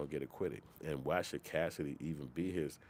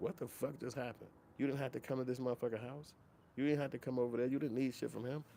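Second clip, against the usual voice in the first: higher, exasperated disbelief; you didn't have to come, said with a raised exasperated pitch; incredulous; 8 semitones higher than usual. Real, not acted. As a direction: scold them in high disbelief. Incredulous rant at accusers.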